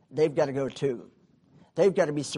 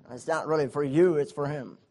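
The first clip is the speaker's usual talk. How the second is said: ordinary delivery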